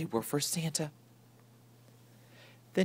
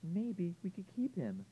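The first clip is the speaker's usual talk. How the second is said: put-on high, soft little girl; lightly voiced young girl; 7 semitones higher, 8 dB quieter than usual. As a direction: speak up sweetly as a small child. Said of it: "said Alice Jane".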